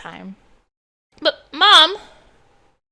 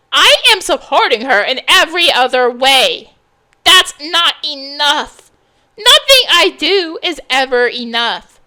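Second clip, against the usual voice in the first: loud, hurt shouting back; angry outburst; 8 dB louder than usual. Acted. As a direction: raise the voice, defending herself. Heated quarrel between mother and daughter.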